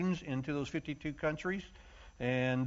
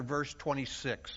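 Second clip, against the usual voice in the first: normal talking voice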